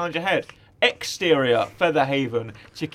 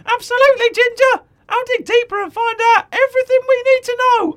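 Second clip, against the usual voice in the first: very high, loud, eager squeak; Penny vowing to Ginger, high and determined; 8 dB louder than usual. Acted. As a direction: promise it with gung-ho resolve.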